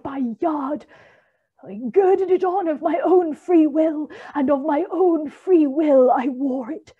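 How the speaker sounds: high, loud wailing spirit; Marley's ghost demanding belief from Scrooge; ghostly voice. A narrator's reading. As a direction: ring it out like a tormented ghost. The ghost speaks.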